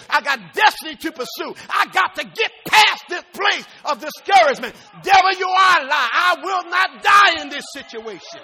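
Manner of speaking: loud, driving sermon building to a shout; full-force preaching. Acted. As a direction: preach it defiantly, swelling to a climax.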